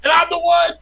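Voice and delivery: squealed high and loud gloat. Real, not acted. Gloating over rival's first win.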